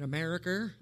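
"America" ending in "er"; New England "America" with a final "er". Shown as a demonstration. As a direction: finish "America" with an r sound, as "Americ-er". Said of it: "pronounced with an E R".

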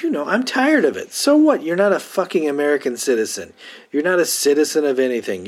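loud, high-pitched scolding pep talk; exasperated rant. Acted. Fed-up sidekick scolding his vampire boss, exasperated and loud. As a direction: tell them off hard, hammering the key words.

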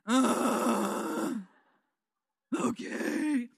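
a reluctant groaning okay; grumpy groan. Retold or imitated. Preteen answering mom's wake-up call, grumbling. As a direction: drag it out, annoyed to be woken.

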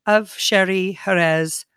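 ordinary talk, nothing marked

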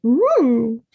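normal talking voice

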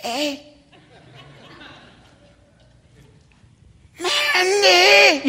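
hushed call rising to a held-back shout; whisper shout. Retold or imitated. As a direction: call her urgently without scaring the deer. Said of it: "it's a whisper shout".

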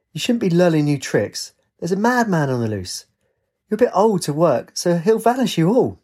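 high, urgent scolding; warning. A narrator's reading. Lynx telling the old lady of a madman, warning. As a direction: warn of danger, keeping the pitch up.

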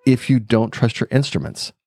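retold warning, slow with weighty pauses; very dramatic. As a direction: imitate a dramatic instructor, drawing out the final words. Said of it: "he was very dramatic about it".

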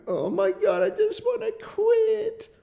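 very high, strained mock whine, drawn out at the end; squirming whine. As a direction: squeal it like you can't bear it. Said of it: "sitting there squirming".

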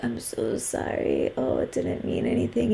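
mock the guilty boyfriend's meek apology; sheepish apology. Imitating the cheating boyfriend, mock sheepish and meek. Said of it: "so sheepish and they have their tail between their legs".

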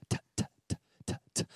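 whispered, voiceless ticks; soft dripping taps made with the tongue; imitated tapping noise. Father imitates water tapping in the garage, soft voiceless clicks. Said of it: "I started to hear this tapping noise".

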